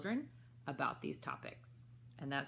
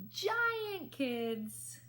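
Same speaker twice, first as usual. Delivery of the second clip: hammed-up giant, higher and strained; playful giant voice; 13 semitones higher than usual. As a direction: puff up like a giant. Big voice for giant.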